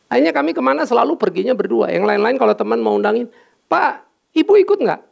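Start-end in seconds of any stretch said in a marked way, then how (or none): 3.7-5.0 a much higher, eager friend asking; friend's bright invite. Retold or imitated. Friends inviting, quoted question. (teman yang bertanya dengan suara jauh lebih tinggi)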